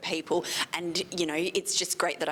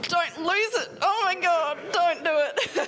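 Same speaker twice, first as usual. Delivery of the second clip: higher and louder, pleading with herself; holding back tears; 12 semitones higher, 5 dB louder than usual. Retold or imitated. Photographer retells talking herself out of crying behind the camera, high teary plea. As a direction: urge yourself not to cry, half laughing. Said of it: "And I'm behind the camera going".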